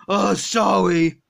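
put-on low slurred drunk voice; sluggish, drunk and lazy; Homer Simpson.

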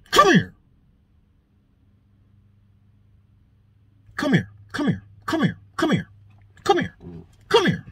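high, quick repeated calls; stern summons. Acted. Relative calling Russell over to check him, insistent.

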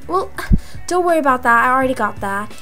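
ordinary talk, nothing marked

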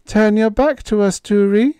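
put-on high, loud, trembling call of a girl; quavering call. A narrator's reading. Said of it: "she called suddenly".

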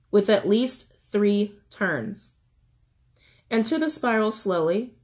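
slow, separated phrases; slow reading. Shown as a demonstration. Reiterating instructions for anyone struggling to shed, slow reading. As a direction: take the instruction slowly, pausing between steps. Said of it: "I'll try to read it slowly".

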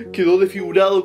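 ordinary talk

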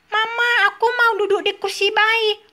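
put-on high little-child voice, eager; a toddler chirping to his mama, pitched high; baby telling Mama he wants to go, high child voice; baby Tom Tom voice. Toy baby asks for seat.

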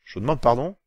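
indignant surprise. Acted. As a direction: recoil at the insult, voice jumping up. Shocked reply to accusation.

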